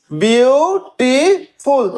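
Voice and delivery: Beautiful split very slowly into three separate beats; syllable by syllable. Shown as a demonstration. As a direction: count out beautiful as beau, ti, ful with clear gaps. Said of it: "তিনটে syllable". (Beautiful খুব ধীরে, তিন সিলেবলে কেটে কেটে)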